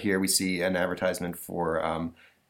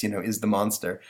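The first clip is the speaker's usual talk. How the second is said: normal talking voice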